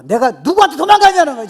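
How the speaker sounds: high, loud indignant cry; defiant retort. Acted. David answering those who say flee, defiant shout. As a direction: throw the challenge back at full voice. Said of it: "그랬더니 다윗이 뭐라 하잖아요".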